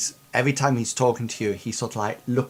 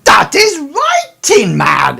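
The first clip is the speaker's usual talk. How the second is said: much higher and louder outburst; excited burst; 13 semitones higher, 13 dB louder than usual. Acted. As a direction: leap up with delight.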